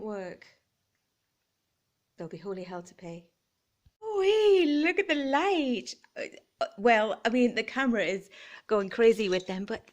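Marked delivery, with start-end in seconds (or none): 4.2-5.9 high, loud squeal of delight at the lights; thrilled gasp-out as the lights come on. Real, not acted. Vlogger delighted by her new fairy lights, squealing.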